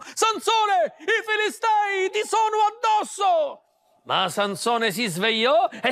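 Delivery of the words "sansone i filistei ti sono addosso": shouted alarm. Acted. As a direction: yell the warning across the room. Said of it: "gridò di nuovo".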